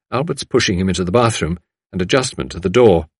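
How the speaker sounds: ordinary delivery, nothing marked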